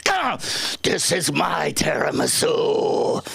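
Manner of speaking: guard your dessert, mock fierce; mock possessive snap. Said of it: "she goes".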